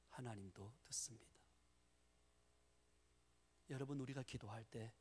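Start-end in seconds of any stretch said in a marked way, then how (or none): 0.1-1.3 breathy whisper. Real, not acted. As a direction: hush it like a secret. Whispered punchline translation.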